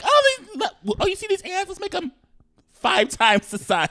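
very high, excited pretend voice; high-pitched mock excitement. Podcaster mocking whoever made giant ants, high excited voice. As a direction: squeal the bright idea in mockery.

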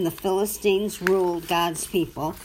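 usual speaking voice

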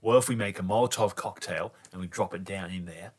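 usual speaking voice

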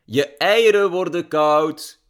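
loud, high, drawn-out holler; slow, stretched shout down the hall; calling after someone. A narrator's reading. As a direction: yell it after her as she leaves. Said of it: "riep Daddy G haar achterna".

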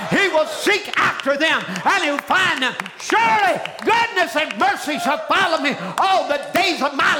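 high, driving revival-preacher shout; preaching at full force. Real, not acted. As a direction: preach it loud and urgent, hammering each phrase.

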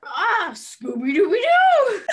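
high, slow, loud cartoon dog howl; Scooby-Doo impression. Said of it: "now on to Scooby-Doo".

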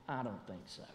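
low, soft, defiant refusal; a low quiet no that won't budge; emphatic refusal. Acted. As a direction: quietly dig in and refuse to leave. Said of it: "it's so emphatic".

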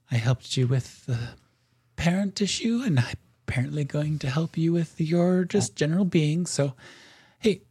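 a casual patron spirit checking in; chatty spirit. Acted.

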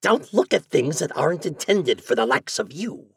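high, sneering imp voice. A narrator's reading. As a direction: taunt like a little devil. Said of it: "said the imp".

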